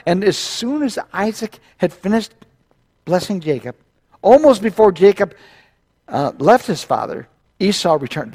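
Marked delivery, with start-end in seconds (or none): none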